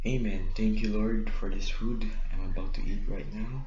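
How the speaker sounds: loud fervent prayer before eating; saying grace. Real, not acted. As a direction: pray loudly over the food. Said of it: "I'm gonna pray now".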